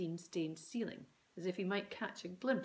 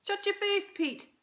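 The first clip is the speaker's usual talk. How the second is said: snapping mum; 14 semitones higher, 7 dB louder than usual. A narrator's reading. Mum snaps at Dad.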